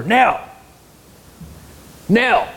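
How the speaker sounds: high mock call of her name; retold calling out. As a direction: call out to get her attention. Husband calls wife from lion's jaws.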